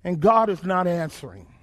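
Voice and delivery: ordinary talk, nothing marked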